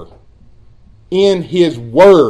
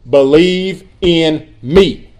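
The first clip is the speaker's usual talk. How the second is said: BELIEVE IN ME very slow, word by word; slow, weighty delivery of BELIEVE IN ME; emphatic command; much slower than usual. Retold or imitated. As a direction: stretch out each word of BELIEVE IN ME. Quoting Jesus' simple command.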